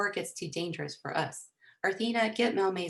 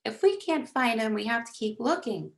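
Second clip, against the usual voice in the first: raised, higher-pitched pleading; upset protest; 5 semitones higher, 5 dB louder than usual. A narrator's reading. Girl begging to keep searching, mildly tearful protest. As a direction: plead with a touch of distress, no real sobs. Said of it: "bursting into sobs again".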